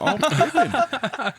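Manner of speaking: high, slow, hammed-up moan. Shown as a demonstration. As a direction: overdo the moan while laughing. Hamming the moaning tag.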